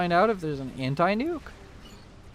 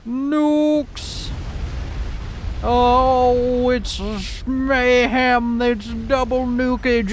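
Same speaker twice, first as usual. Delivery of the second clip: high, drawn-out caster's cry; climactic commentary; 7 semitones higher, 3 dB louder, much slower than usual. Real, not acted. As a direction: call the big moment, stretching the words out. Calls the nuke strike live.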